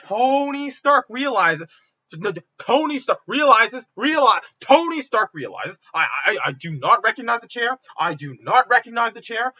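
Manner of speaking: loud, emphatic talking over objections; insistent. Real, not acted. Speaker pitching his plot over the chair's objection, insistent.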